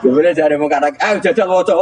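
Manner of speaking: high voice, laughing through the quote; laughing retelling. Real, not acted. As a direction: giggle while voicing Umar's snap. Umar snaps at the angels. (menirukan Umar sambil tertawa dengan suara tinggi)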